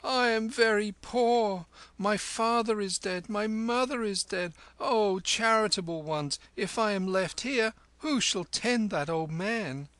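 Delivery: put-on a boy's high, weepy plea; tearful wailing plea. A narrator's reading. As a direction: wail it out to the carriage for pity. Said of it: "Kim lifted up his voice and wept".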